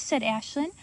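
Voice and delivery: plain reading voice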